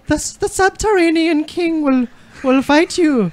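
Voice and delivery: high, theatrical British read; hammed-up royal British; British accent. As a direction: announce like the Queen. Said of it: "don't just sound like the Queen".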